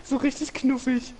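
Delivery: very high cooing voice; cutesy baby talk. Pretend. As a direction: coo like at a puppy. Cooing over angry character.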